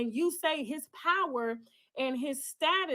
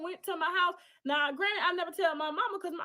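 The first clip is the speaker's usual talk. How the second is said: ordinary delivery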